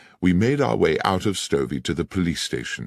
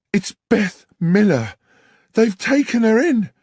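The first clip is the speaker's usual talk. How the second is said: put-on much higher, louder breathless young girl; distraught young woman; 7 dB louder than usual. Young woman tells the landlord his son's betrothed is taken, panicked. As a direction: blurt it out in a panic.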